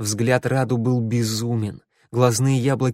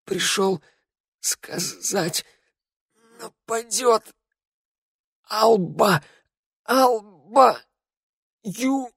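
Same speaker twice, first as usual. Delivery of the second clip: high, halting, breath running out; last words between gasps; dying gasps; 10 semitones higher, much slower than usual. A narrator's reading. Dying man gasping words.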